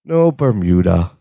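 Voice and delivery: low, slow, dejected murmur; sad Larry. Retold or imitated. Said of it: "very sad Larry".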